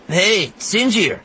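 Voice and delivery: put-on a kid hailing a friend from behind; boy's voice. A narrator's reading. Narrator voices the boy Temin.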